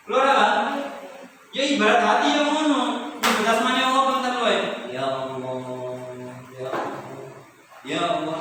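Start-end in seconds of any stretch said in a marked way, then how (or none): 4.9-8.4 mildly tearful prayer. Retold or imitated. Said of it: "langsung nangis".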